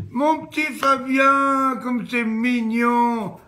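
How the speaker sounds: high, slow doting coo; teacher gushing over little Fabien, cooing. Acted. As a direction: melt over a cute little boy.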